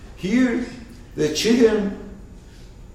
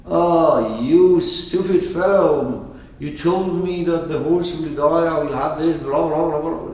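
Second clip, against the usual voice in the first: angry dog; 5 semitones higher, 7 dB louder than usual. Acted. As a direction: let the dog drag out the insult, louder. Angry dog scolds chicken.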